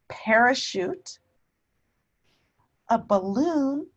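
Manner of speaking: put-on each word said loud and clear for children; name each word slowly to the class; reading word list. Shown as a demonstration. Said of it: "let's look at these words".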